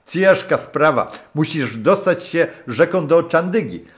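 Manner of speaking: put-on high, loud hearty old sailor's voice; a rough old skipper warming to his guest; gruff old captain. (serdeczny, szorstki głos starego kapitana)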